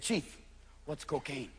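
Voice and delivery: soft, low interviewer; quiet polite question; reporter asking. Retold or imitated. Documentary interviewer asking the chief, soft.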